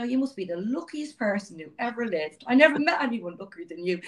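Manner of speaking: mock sarcastic. London colleague teasing Donna about luck, sarcastic. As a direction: lay on the fake praise, tongue in cheek. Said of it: "he was obviously being sarcastic".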